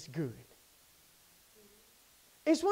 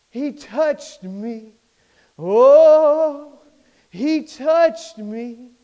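awed Jacob; 10 dB louder, much slower than usual. Retold or imitated. Voicing Jacob, awed, slow.

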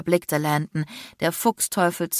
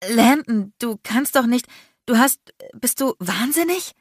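higher, halting, disbelieving stammer; 6 semitones higher than usual. A narrator's reading. Shaken woman confronts her rescuer, stammering. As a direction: break off and restart in shock. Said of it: "stammelte ich".

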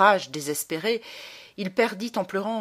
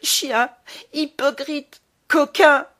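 hurled insults, rising to a full scream; howling insults; 8 semitones higher, 7 dB louder, slower than usual. A narrator's reading. Boy screaming insults at the baron, furious howl. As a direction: spit each insult out through tears. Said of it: "en hurlant".

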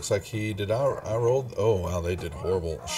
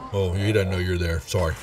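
normal talking voice

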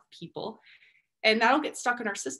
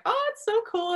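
much higher-pitched burst of excitement; excited gushing. Acted. Speaker showing how they react to cool discoveries, excited. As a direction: gush with delight, pitch leaping way up. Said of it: "I get really excited about things".

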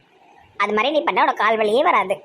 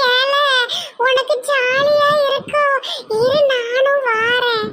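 put-on high, slow, stretched kid voice; eager child; 12 semitones higher, much slower than usual. Child asks to join the hand-walking, eagerly drawling. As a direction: call out like a child wanting in.

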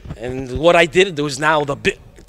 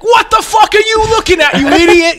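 high, loud mock shout at the jockey; mock yell; 7 dB louder than usual. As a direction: bark it at the jockey, hammed-up. Yelling at jockey in retold story.

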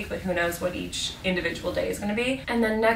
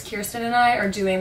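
normal talking voice

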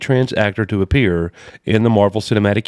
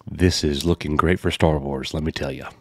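low, soft deadpan sarcasm; muttered mock approval, well below usual pitch; sarcastic; 5 semitones lower, 5 dB quieter than usual. Real, not acted. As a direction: say it drily, not meaning it. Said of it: "That's sarcasm".

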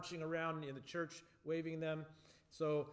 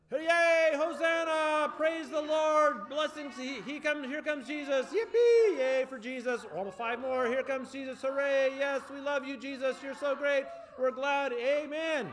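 much higher, much louder joyful shouting; noisy praise shout; 9 semitones higher, 10 dB louder than usual. Real, not acted. Leader leading ten seconds of noisy praise, shouting. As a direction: yell your praise with everything. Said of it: "we're gonna be being noisy".

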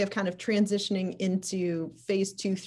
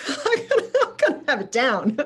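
laughing through the words, pitched up high at first; 5 semitones higher, 5 dB louder than usual. Real, not acted. Host laughing at own seventeen-month reading plan, laughing. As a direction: break into real laughter while talking.

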